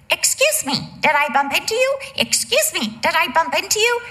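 talking toy robot apologizing to the glass door. Retold or imitated. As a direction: sound like a bumping toy robot.

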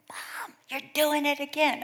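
put-on high-pitched kid's complaint; imitated complaining kids. Kids catching mom's accent on the phone, imitated high complaint.

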